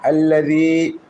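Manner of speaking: আল্লাযী with a soft ذ, tongue tip touching the teeth; soft zal sound. Shown as a demonstration. Teacher repeats the soft ذ of আল্লাযী for students. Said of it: "লাগাইয়া নরম করে উচ্চারণ হয়".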